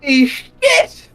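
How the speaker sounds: sudden yelp of alarm; startled shout. Real, not acted. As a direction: jump and shout in shock. Reacts to lightning bolt near miss.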